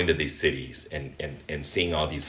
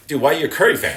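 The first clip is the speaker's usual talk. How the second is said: a higher, louder incredulous buddy; half-mocking disbelief, pitched up; mocking Philly fan; 9 semitones higher, 6 dB louder, faster than usual. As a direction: needle him like a Sixers fan who can't believe it. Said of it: "and they're like".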